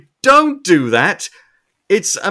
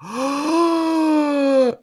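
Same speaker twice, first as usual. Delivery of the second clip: mock gasp; 9 semitones higher than usual. Acting out movie scene.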